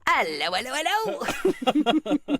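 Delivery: mock British singsong, leaping high on the end; Mary Poppins. Shown as a demonstration. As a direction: swoop up in a hammed-up British accent. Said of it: "sound like Mary Poppins".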